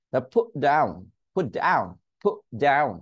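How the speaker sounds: "put" cut short with an unreleased T; stopped T. Shown as a demonstration. Teacher drilling the held-back T, stopped T. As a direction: catch the T in "put", then say "down". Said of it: "টি উচ্চারণ করা থেকে আটকে দিয়েছি".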